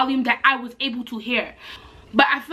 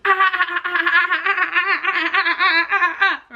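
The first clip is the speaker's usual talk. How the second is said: mock loud Korean chatter; 5 semitones higher than usual. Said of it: "talking to him in Korean so loud".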